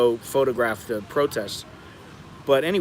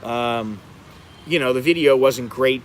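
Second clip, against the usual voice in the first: ordinary talk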